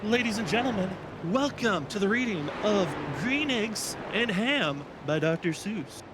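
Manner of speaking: hammed-up book-reading introduction; announcer intro. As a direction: present the book like a ringmaster. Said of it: "I think it might be camera time".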